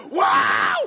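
explosive holler at a runaway horse; desperate yell. Retold or imitated. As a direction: yell it for dear life. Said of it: "Think the more I yell".

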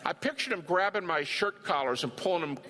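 ordinary talk, nothing marked

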